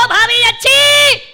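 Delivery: high, loud, drawn-out call; acted yell to sister-in-law, stretched out; hollered greeting. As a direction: shout it like the old taunt. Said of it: "भाभी समझी फिर काणी चिल्लाएगा".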